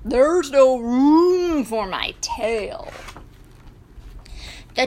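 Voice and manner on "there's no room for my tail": slow, mooing cow complaint; drawn-out cow voice; bossy cow. Acted. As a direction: stretch the words into moos. Bossy cow keeps talking.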